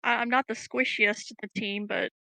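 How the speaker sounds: usual speaking voice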